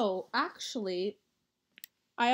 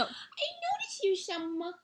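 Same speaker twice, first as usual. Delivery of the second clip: creepy voice; 10 semitones higher than usual. Pretend. Creepy playful voice.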